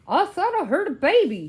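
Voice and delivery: loud, high alarmed outcry; crying out. A narrator's reading. Museum man running downstairs in his bathrobe, loud cry. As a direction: burst in calling it out high and loud. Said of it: "he cried".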